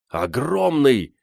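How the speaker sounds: high, very drawn-out marvelling; still overexcited. A narrator's reading. Гриша still thrilled after seeing the bear, excited. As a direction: stretch it out in wonder. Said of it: "не мог остыть от пережитого волнения".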